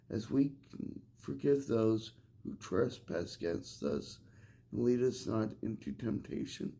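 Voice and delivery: low, soft, reverent recital; reciting a prayer. Real, not acted.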